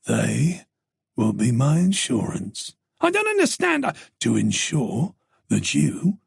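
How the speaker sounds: the stalker taunting Tess; sinister stranger. Acted. Stalker voiced by narrator.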